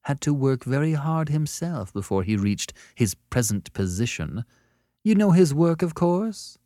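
smooth, lifted, politely barbed voice; smooth needling. A narrator's reading. Annette asks if he knows the great man's work, smoothly. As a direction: needle him under a smooth surface. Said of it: "she said smoothly".

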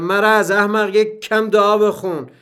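loud, high, fed-up outburst; exasperated shout. A narrator's reading. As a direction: yell it like someone at the end of their patience. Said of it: "کلافه داد زدم".

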